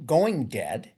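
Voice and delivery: ordinary talk, nothing marked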